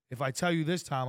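usual speaking voice

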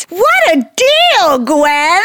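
high, slow sarcastic drawl. Pretend. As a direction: stretch it out in mock awe. Mock reaction to price.

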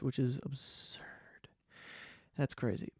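breathy unvoiced aside, hushed; whisper. Real, not acted. Marvelling at his own view count in a whisper. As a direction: whisper it like a secret.

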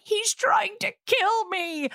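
put-on high, slow quavering old-man plea; frail old man. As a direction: whimper it like a scared old man. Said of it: "the old man's like".

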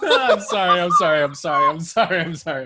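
loud, high giggling apologies; laughing apology. Real, not acted. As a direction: apologize while cracking up. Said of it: "There's just lots of laughing happening".

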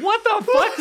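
high, squealed disbelief; mock disbelief. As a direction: shriek it in fake outrage. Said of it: "everybody's like".

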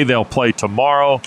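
normal talking voice

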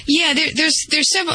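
ordinary talk, nothing marked

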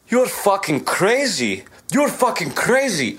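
very high-pitched mock Sri Lankan accent. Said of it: "I don't know why I'm giving him a, a, a fucking Sri Lankan accent".

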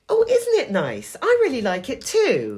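high, slow delighted gush. Retold or imitated. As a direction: gush over the compliment. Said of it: "someone goes".

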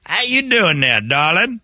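high folksy Southern voice; sweet-talking Southern lawman; drawling Southern sheriff. Acted. Southern sheriff impression bit.

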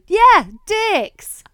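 put-on high, loud childlike "Yeah, dicks"; a toddler happily doubling down; toddler insisting. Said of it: "And she'll go".

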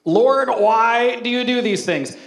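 loud, slow, drawn-out cry of "Lord, why"; mock lament to God. Pastor hamming up his cry to God over a paper cut, loud and slow. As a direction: wail it up to heaven, hammed-up. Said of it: "I blamed God for a paper cut".

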